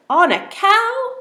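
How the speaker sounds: high, loud, slow rise of disbelief; disbelieving question. Shown as a demonstration. Teacher acting the girl's amazement at riding a cow. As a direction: ask it like you can't believe it. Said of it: "Can you hear the question in her voice?".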